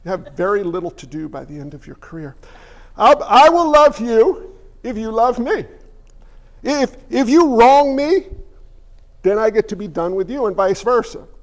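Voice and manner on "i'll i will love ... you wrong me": a hint of a smug pastor, slow and louder; self-serving pastor. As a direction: spell out the deal like a bargaining pastor. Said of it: "You know, it's like".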